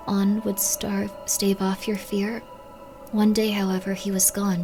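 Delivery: soft, hushed reading of game text; reading aloud. Acted.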